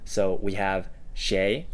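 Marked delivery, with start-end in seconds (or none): 1.1-1.7 谁 with a rising second tone. Shown as a demonstration. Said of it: "with the second tone".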